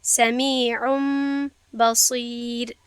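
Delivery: «سمیعم» ending in a held nasal m, very slow; slow, drawn-out m at the end of «سمیعم»; iqlab with ghunna. Shown as a demonstration. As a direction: hum the final m into «بصیر».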